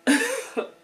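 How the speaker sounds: real amused laugh; high laugh. Real, not acted. Laughs after singing.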